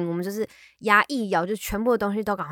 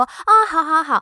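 bright, loud, quick yeses, much higher; gladly grab the offered food; eager yes yes; 8 semitones higher, 7 dB louder than usual. Retold or imitated. Retelling how she eagerly says yes to mom's food offer.

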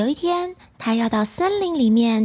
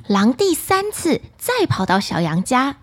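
plain reading voice

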